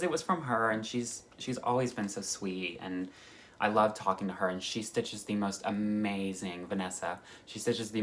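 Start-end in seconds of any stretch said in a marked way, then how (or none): none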